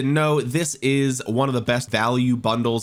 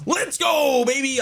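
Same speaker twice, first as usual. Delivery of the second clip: very high, drawn-out victory shout; excited celebration; slower than usual. Real, not acted. Player celebrates a lucky spin, excited high shout. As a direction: whoop it out, pitched way up.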